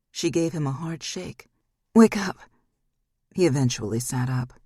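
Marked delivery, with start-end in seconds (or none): 1.9-2.4 loud, sharp wake-up call; loud urgent command; urgent shout. Acted. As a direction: shout him awake. She shakes him awake.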